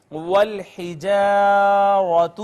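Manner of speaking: slow, drawn-out tajweed chant; Quranic recitation. Shown as a demonstration. Teacher recites the verse for students, slow chant. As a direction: stretch each vowel as in recitation.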